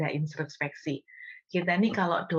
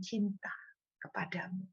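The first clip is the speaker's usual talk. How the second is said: whispered devotion; 3 semitones higher, 8 dB quieter than usual. Retold or imitated. Intimate prayer to God.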